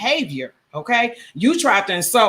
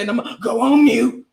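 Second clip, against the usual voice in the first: mocking impression; 3 semitones higher, 3 dB louder than usual. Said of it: "that's all I have to say".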